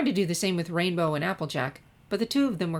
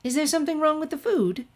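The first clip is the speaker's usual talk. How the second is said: Rarity; 10 semitones higher than usual. A narrator's reading. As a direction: sing out darling like a fine lady. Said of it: "asked Rarity".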